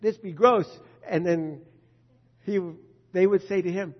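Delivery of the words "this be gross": put-on louder child complaint. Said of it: "I said".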